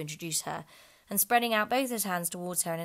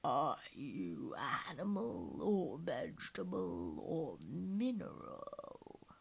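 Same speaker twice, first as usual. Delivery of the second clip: slow, sleepy yawned words; slow, drowsy lion voice; yawning; 4 dB quieter, much slower than usual. Acted. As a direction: yawn through the question. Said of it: "yawning at every other word".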